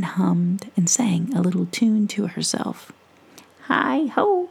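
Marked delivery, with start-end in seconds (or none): 3.7-4.5 merry gnome cry. Acted. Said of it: "cried the merry gnomes".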